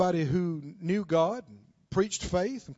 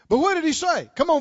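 normal talking voice

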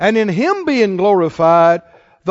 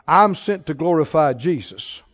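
quiet voice of the Spirit; 5 semitones lower, 4 dB quieter than usual. Retold or imitated. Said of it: "He told me".

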